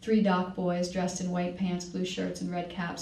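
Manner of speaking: ordinary delivery, nothing marked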